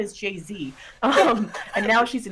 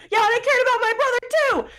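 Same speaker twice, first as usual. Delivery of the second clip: mock retort; 4 dB louder than usual. Quoting a retort.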